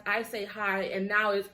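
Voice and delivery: normal talking voice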